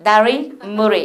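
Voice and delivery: Korean words in a mock British accent, English r; slow British-style r in each word. Shown as a demonstration. Teacher imitating a Briton saying Korean words, British accent. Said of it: "영국 사람처럼 발음을 해보면".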